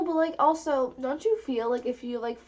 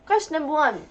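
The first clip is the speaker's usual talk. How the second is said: heading read out louder; louder announcing; 4 semitones higher, 8 dB louder than usual. Shown as a demonstration. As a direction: announce it with more punch. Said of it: "keep going".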